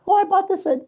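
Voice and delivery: high-pitched imitated gushing lady; lady party guest. Lady at a party pointing out her painting, high-voiced. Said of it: "This lady's like".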